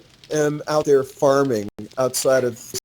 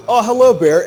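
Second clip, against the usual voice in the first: cheery greeting; 7 semitones higher, 7 dB louder than usual. Retold or imitated. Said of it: "he's like".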